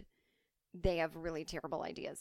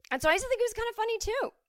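much higher, fast and louder; mock high voice; 7 dB louder, faster than usual. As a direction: squeak it out in a silly voice. Self-mocking high voice.

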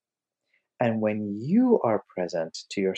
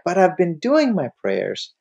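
higher, louder mock protest; acted insistent complaint; 7 semitones higher, 5 dB louder than usual. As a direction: object as the exasperated pupil. Acted pupil protesting a third time.

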